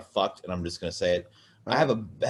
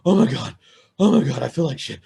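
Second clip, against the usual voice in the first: acted panting, higher and louder; breathing hard; 6 semitones higher, 6 dB louder than usual. Man re-enacting his hard workout, breathless and strained. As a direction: gasp it out like mid-workout. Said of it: "I'm fucking breathing hard".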